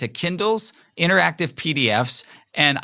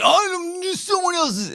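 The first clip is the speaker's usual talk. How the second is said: much higher, squeaky put-on voice; mock Carl voice. Teasing teammate Carl about not trusting others, squeaky mock voice. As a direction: mimic a teammate in a silly high voice. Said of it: "And that's not how he talks".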